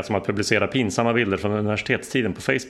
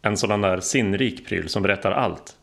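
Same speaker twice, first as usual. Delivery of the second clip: usual speaking voice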